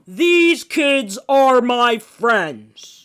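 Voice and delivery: very slow, loud, looming giant; giant's booming voice. Acted. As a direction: loom over the storekeeper. Giant confronts storekeeper.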